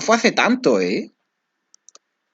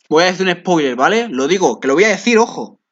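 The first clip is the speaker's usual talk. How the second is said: excited warning; 5 semitones higher, 4 dB louder than usual. Real, not acted. Warns of a spoiler.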